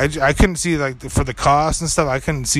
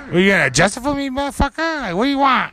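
much higher, fast, goading challenge; mock confrontation; 10 semitones higher, faster than usual. Diner facing down the waitress, mock confrontational. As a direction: pick a fight in a hammed-up high voice.

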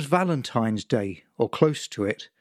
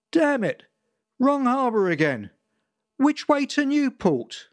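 frustrated boater; 11 semitones higher than usual. Acted. Lost boater cursing his wrong harbour, exasperated. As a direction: blurt it out, fed up with himself. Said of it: "boater cursing, lost".

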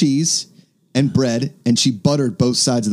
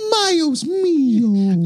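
drawn-out, much higher put-on voice; mock exclamation; 13 semitones higher, much slower than usual. Joking with his co-host, a hammed-up slow high exclamation. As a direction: stretch it out like a hammy character. Joking about a Spanish phrase.

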